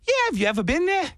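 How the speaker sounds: high, fast, put-on Aussie accent; fake Aussie bluff. Bluffing to real Australians, fast fake Aussie accent.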